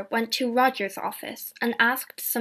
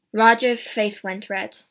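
plain reading voice

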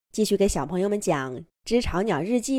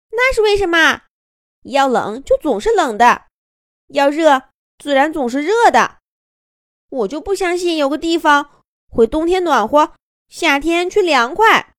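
doubtful little bird; 8 semitones higher, 6 dB louder than usual. Acted.

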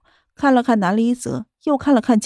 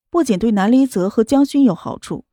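plain reading voice

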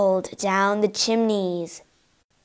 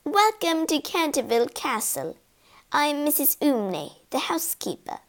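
put-on soft, higher-pitched old woman's voice; old housekeeper; 5 semitones higher, 6 dB quieter than usual. A narrator's reading. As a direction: greet guests softly as an elderly housekeeper. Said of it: "greeted by an old woman".

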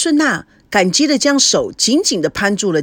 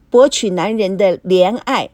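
plain reading voice

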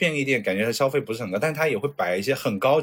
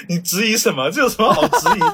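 laughing indignation; 8 semitones higher, 6 dB louder than usual. Real, not acted. Mock offense at doubt.

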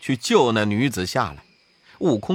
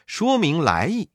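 ordinary delivery

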